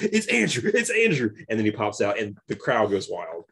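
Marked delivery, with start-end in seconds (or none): 0.0-1.3 high, thrilled recognition; excited. Retold or imitated. Fan spotting Andrew on screen, high and excited. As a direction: squeal the name like a fan. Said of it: "I was like".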